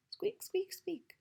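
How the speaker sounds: high squeaky mouse, fading to a whisper; mouse squeak. Acted. Teacher voicing the timid mouse for children, high squeak.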